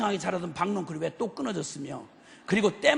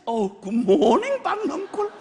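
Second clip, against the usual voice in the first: high, drawn-out doting hello; besotted morning greeting; 6 semitones higher, 5 dB louder, slower than usual. Acted. Jonah greeting his beloved gourd vine, besotted. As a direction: greet the plant like a beloved, slowly. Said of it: "박넝쿨에 홀랑 미쳐가지고".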